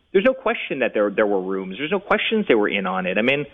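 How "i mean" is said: normal talking voice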